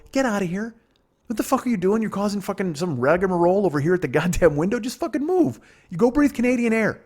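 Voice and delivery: high-pitched, hammed-up telling-off; exasperated rant climbing to a high squeal; mock exasperation. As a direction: shoo them off in acted outrage. Acted telling-off at the customs window.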